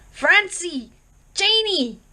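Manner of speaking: high, loud calling out into the snow; bellowing names. A narrator's reading. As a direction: bellow their names across the storm. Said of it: "and bellowed".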